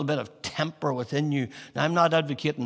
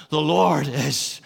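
"The Lord is" drawn out slowly, each word weighed; slow stress on Lord; much slower than usual. Shown as a demonstration. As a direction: dwell on "Lord". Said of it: "are the most three important words".

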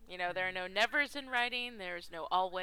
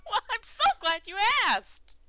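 higher, louder, hammed-up talk-show answer; mock gracious host; 12 semitones higher, 10 dB louder than usual. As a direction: play the delighted guest. Answers her own mock interviewer.

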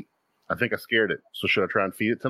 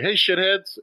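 calling out; 13 semitones higher, 4 dB louder, slower than usual. Pretend. Calling out to snakes.

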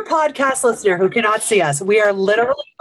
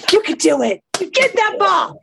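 higher, excited cheer copying the guest; mock puppy pep talk; 8 semitones higher than usual. Host copying the guest's high excited puppy cheer. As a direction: echo her dog cheer playfully. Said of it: "Dion's advice to you".

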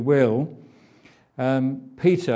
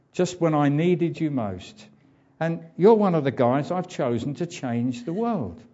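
ordinary talk, nothing marked

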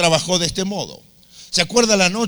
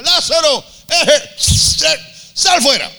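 calling out; 8 semitones higher, 5 dB louder, slower than usual. Retold or imitated. Calling Lazarus from tomb.

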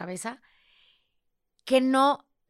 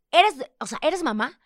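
loud, raised challenge to the doctor; angry demand, replayed; retold outburst; 5 semitones higher, 8 dB louder than usual. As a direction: throw the question back at her, indignant. Confronting the pediatrician angrily.

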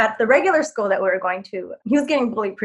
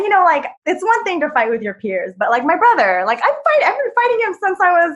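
ordinary talk, nothing marked

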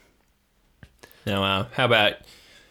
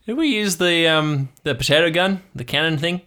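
high, searching uncle voice; puzzled uncle; 9 semitones higher, 5 dB louder than usual. Acted. Uncle tries to recall a hunting trip, high and puzzled. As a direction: grope for the memory in a lifted voice. Plays Uncle Marv reminiscing.

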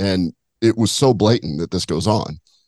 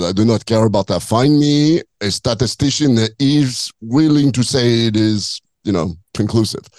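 put-on loose Russian accent on the attending's words, pitch pushed up and louder; Russian accent, a bit raised and insistent; imitating a Russian accent; 4 semitones higher, 4 dB louder than usual. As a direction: quote the liver specialist in a mock thick Russian accent. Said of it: "in a very thick Russian accent".

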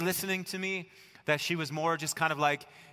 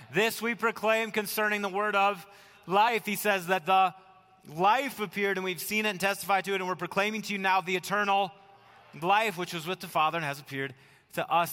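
raised, ringing scripture read; 5 semitones higher than usual. Acted. Pastor reading scripture aloud to the congregation, proclaiming.

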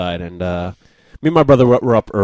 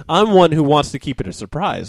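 normal talking voice

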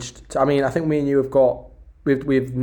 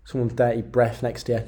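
ordinary talk

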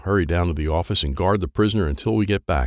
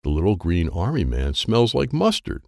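ordinary delivery, nothing marked

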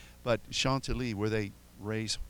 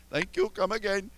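mock Indian accent; 9 semitones higher, 4 dB louder than usual. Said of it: "he's speaking broken English".

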